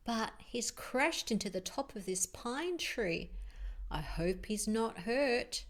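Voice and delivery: usual speaking voice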